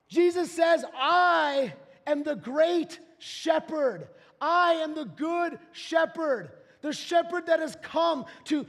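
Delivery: preacher declaring Jesus' words to the church; full-force preaching. Real, not acted. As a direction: thunder it out from the pulpit.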